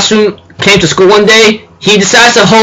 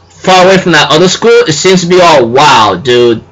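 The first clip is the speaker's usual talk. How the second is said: normal talking voice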